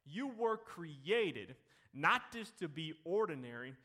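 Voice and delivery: ordinary talk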